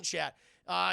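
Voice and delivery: usual speaking voice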